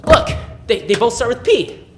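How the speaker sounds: loud, high burst of excitement; stumbling, loud and thrilled; excited discovery. Acted. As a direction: exclaim it like a sudden revelation. Monologue character's sudden silly discovery.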